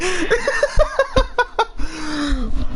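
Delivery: high breathless laughter; giddy laugh. Real, not acted. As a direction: laugh helplessly at the chaos. Laughing at the chaos.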